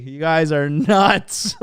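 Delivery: slow words squeezed out through laughter; still laughing. Real, not acted. Streamer teasing his chat, still laughing. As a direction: laugh it out as you tease them. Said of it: "I'm laughing so fucking hard".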